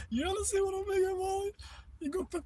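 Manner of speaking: very high, soft sing-song to a cat; high cooing voice for Molly; pet baby talk. Acted. Talking to her cat Molly.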